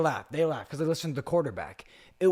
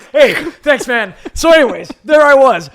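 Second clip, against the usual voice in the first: high, loud words through real laughter; loud laughing; 10 semitones higher, 16 dB louder than usual. Real, not acted. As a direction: shout the resumption while cracking up. Cracking up after racist remark.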